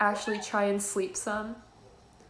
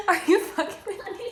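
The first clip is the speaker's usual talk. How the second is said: laughing; 9 semitones higher, 6 dB louder than usual. Real, not acted. Laughing at friend's giggles.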